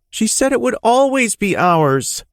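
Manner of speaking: put-on high, rising whiny boy's voice; little boy getting worked up; child winding up. A narrator's reading. Boy protesting to his dad, getting upset. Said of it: "his voice winding up".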